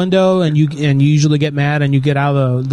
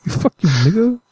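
mock thug line through a laugh; 5 semitones higher than usual. Laughing at his own prank call, mock tough talk. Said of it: "imitating the call laughing".

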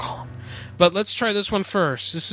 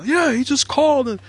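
office worker voice; 5 semitones higher than usual. Acted. Acting the social worker.

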